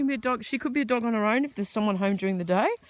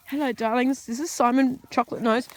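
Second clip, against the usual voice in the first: high, louder cooing to the dogs; pet talk; 5 semitones higher, 7 dB louder than usual. Real, not acted. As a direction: sweet-talk the puppies.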